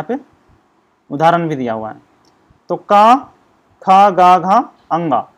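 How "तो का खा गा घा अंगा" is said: loud, separate syllables: का, खा, गा, घा, अंगा; sounding out the throat consonants. Shown as a demonstration. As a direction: call out each sound like a teacher showing it. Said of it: "का बोल के देखिए, खा बोल के देखिए".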